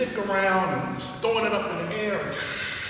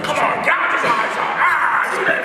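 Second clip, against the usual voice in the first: high, louder hammed-up corner trainer; Mick from Rocky; 9 semitones higher, 5 dB louder than usual. Teacher coaching from the corner, as an over-the-top Mick. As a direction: egg him on like a boxing cornerman. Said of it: "started calling him Mick from Rocky".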